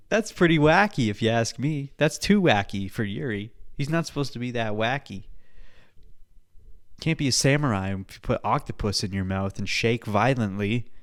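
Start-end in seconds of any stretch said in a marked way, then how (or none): none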